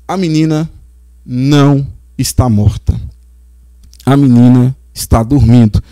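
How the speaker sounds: Jesus soothing the crying family, hushed and tender; calm, gentle Jesus. Acted. Jesus calmly speaks at mourning house.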